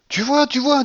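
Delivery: high, loud burst of delight; excited, radiant exclamation; lively, beaming. A narrator's reading. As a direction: crow over the win. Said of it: "dit vivement la babouchka, toute rayonnante".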